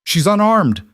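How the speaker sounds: a high, loud shout. A narrator's reading. Cole shouting at Webb to protect the fleeing woman, high and loud. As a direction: shout her innocence at him. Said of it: "Cole shouted".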